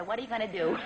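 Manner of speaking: ordinary talk, nothing marked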